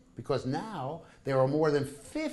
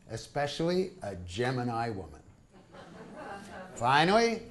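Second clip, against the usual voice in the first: ordinary talk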